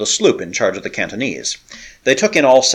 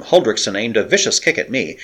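usual speaking voice

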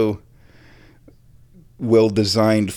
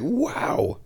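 impressed; 8 semitones higher, much slower than usual. Retold or imitated. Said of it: "And it's like".

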